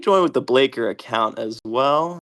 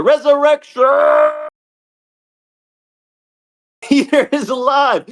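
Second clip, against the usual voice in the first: excited shout; 11 semitones higher, 5 dB louder than usual. Real, not acted. Announcing a revived player.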